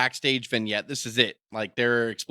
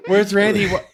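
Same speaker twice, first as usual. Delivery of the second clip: higher, raised voice as a confused woman; mock alarm; 6 semitones higher, 6 dB louder than usual. Waking woman asking where her partner is, mock alarm. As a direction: act out waking up bewildered. Voicing woken Elizabeth, alarmed.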